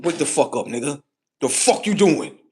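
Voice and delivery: loud, rising mock yell; rough wake-up. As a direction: jolt him awake, half-joking. Acted quote, shaking someone awake.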